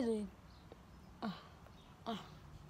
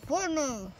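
cheeks stuffed, laughing; mumbled through grapes; mouth full; 3 semitones higher than usual. Real, not acted.

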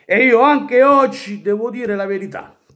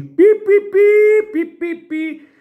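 high squeaky pi pi; mock beeping; 12 semitones higher, 4 dB louder, slower than usual. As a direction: chirp it like a taunting beep. Fan mocks rivals with beeps.

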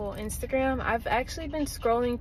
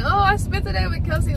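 imagined viewer; 8 semitones higher, 6 dB louder than usual. Retold or imitated. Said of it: "Imagine being like".

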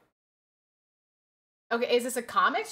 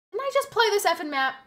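annoyed outburst; 8 semitones higher, 5 dB louder than usual. Real, not acted. Annoyed at repeat map.

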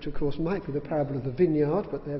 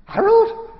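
a sudden, much higher, louder "Harold?" of disbelief; astonished question; 13 dB louder than usual. Retold or imitated. Father startled by his daughter's answer, high disbelieving cry. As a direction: squeak it out in amazement.

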